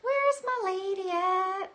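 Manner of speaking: high, slow lovesick call; mock lovesick. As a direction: play the lonely suitor lightly. Voicing the viewer's longing.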